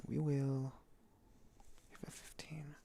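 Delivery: whispered game moves; whispering. Real, not acted. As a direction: whisper it to yourself. Muttering while playing.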